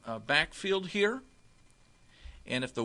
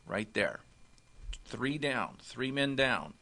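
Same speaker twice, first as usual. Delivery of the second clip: ordinary talk, nothing marked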